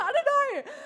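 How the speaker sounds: high giddy laughing; breathless laughing not knowing. Retold or imitated. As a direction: laugh through it, bewildered. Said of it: "I was like".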